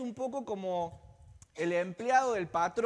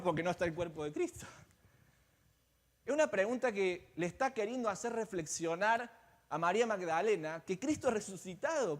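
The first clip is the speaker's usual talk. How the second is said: ordinary talk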